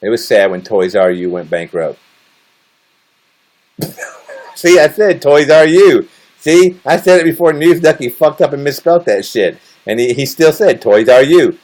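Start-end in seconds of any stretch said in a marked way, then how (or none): 4.6-11.6 higher and louder, delighted; excited. Real, not acted. Pointing out to chat he said it first, excited and loud. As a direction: crow about the lucky slip.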